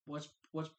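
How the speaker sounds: ordinary talk, nothing marked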